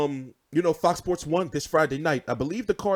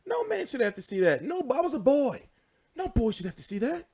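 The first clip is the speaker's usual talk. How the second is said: higher-pitched plaintive protest; mock traumatised; 7 semitones higher than usual. Fan who saw the photos as a boy, plaintive mock trauma. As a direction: plead like a wronged innocent.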